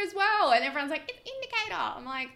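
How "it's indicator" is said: much higher pitched, whiny mock correction; mocking commenter. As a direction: mimic a know-it-all, high and nasal-free but pinched up.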